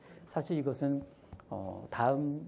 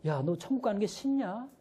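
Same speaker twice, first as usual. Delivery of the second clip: higher exasperated teacher; higher flustered question to a child; 9 semitones higher than usual. Retold or imitated. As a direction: ask like a teacher caught off guard. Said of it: "당황한 선생님이".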